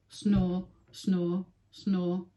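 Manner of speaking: ordinary delivery, nothing marked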